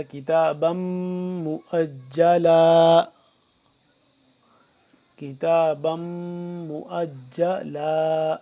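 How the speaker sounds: slow, drawn-out tajweed chanting; measured Quranic recitation. Shown as a demonstration. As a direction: recite each word with long held vowels. Recites with ghunna on meem.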